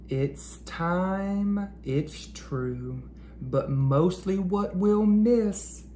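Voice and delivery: put-on sing-song reading of a children's poem; rhyming poem read aloud. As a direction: read the rhyme gently to kids. Reading the class's rhyming note.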